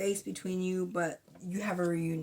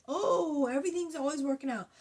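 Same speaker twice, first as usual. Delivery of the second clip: high, fast sing-song cheer; airy fake-cheerful gushing; mock toxic positivity; 7 semitones higher than usual. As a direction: parody a relentlessly upbeat optimist, higher. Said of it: "toxic positivity like".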